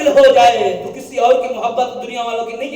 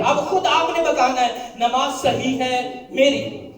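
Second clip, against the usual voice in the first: ordinary talk, nothing marked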